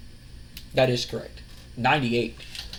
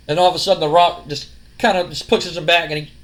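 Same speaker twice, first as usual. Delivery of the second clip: normal talking voice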